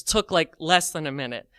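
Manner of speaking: ordinary delivery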